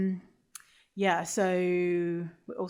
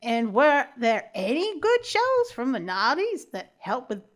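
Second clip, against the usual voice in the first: loud, badly faked Valley Girl accent; 4 semitones higher, 5 dB louder than usual. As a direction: overdo a mock American Valley Girl. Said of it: "turning into the accent again".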